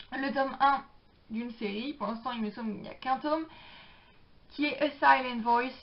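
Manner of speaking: normal talking voice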